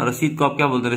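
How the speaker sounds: ordinary talk, nothing marked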